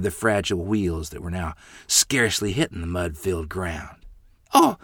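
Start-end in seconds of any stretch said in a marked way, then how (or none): 4.5-4.8 crying, shrieking plea, much higher and louder; shrieked prayer. A narrator's reading. Mother shrieking a prayer as wagon floods. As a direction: wail a desperate prayer to God. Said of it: "Mother shrieked".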